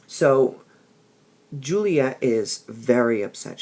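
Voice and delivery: ordinary delivery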